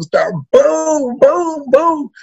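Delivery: high excited boom boom boom; punch sounds. Retold or imitated. Describing beating up the school tough, excited punch sounds. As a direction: mimic the punches landing.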